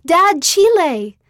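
high, loud, drawn-out burst of excitement; excited exclamation. A narrator's reading. Daughter opening her mission call tells her dad, excited exclamation. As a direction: exclaim it loudly, pitch leaping up. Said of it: "I gasped and exclaimed".

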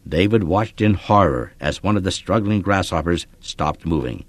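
ordinary delivery, nothing marked

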